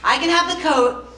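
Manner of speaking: usual speaking voice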